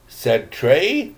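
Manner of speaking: ordinary delivery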